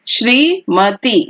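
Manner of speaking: Shrimati stretched slowly, syllable by syllable; slow careful spelling. Shown as a demonstration. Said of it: "उसके बाद श्रीमती".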